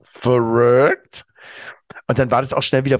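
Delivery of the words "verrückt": surprised. Retold or imitated. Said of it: "Ich so".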